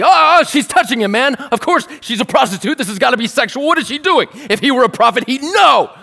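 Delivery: loud, high-pitched mock outrage; hammed-up shocked outburst, rising to a shout at the end; scandalized onlooker. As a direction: act out an appalled religious man, shrieking in judgement. Acts out Simon's shocked judgement of woman.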